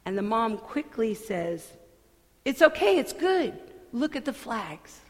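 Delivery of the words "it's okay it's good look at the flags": quick reassurance. Acted. Mom quickly reassures child.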